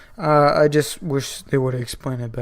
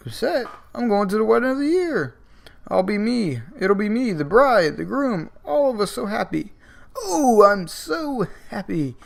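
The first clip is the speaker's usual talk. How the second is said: much higher, a manic, sing-song villain; giddy Joker; 8 semitones higher than usual. Retold or imitated. Joker gushing to Roger about the wedding, manic and high. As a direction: gush like a madman overjoyed at a wedding, nearly crying. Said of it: "And Joker's like".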